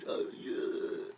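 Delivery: plain reading voice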